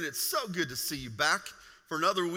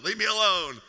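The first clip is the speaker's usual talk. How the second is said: exasperated, louder second plea; 3 semitones higher, 8 dB louder than usual. Retold or imitated. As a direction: leave me alone pushed louder. Lying exhausted on gym floor.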